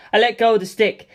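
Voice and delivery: ordinary delivery, nothing marked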